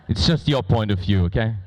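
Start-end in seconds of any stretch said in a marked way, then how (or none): none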